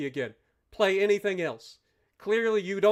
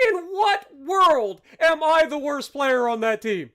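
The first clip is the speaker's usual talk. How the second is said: incredulous; 8 semitones higher, 7 dB louder than usual. Real, not acted. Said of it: "This is more disbelief".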